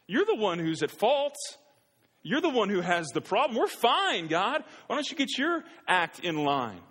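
shrill hammed-up indignation at God; indignant protest. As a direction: throw the blame back at God, incredulous. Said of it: "and they're like".

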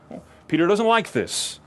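ordinary talk, nothing marked

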